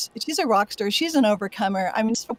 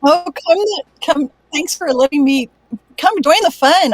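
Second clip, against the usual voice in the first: louder, high, bubbling with joy; excited greeting; 5 semitones higher, 8 dB louder than usual. Real, not acted. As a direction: burst in delighted to join. Joining panel, exuberant greeting.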